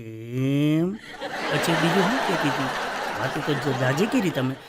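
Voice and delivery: slow, drawn-out teasing probe, half laughing; jealous wife fishing for details, slow and knowing; mock sly wife. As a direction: imitate the wife's sarcastic questioning. Wife keeps probing sarcastically.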